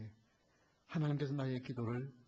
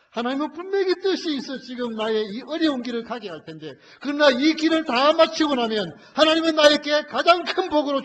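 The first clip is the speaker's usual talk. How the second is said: loud, high impassioned sermon voice; full-force preaching; 11 semitones higher, 22 dB louder than usual. Acted. Preacher pleads loudly with the congregation about Job. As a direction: drive the question home to the congregation.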